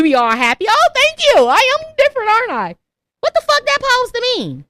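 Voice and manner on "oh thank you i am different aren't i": higher, louder, prissy delighted voice; mock flattered. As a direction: ham up a proud flattered reply.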